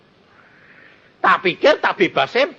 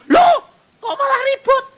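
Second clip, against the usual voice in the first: high, laughing mock of the doubter; 4 dB louder than usual. Pastor mimics doctor's panic, laughing high mock.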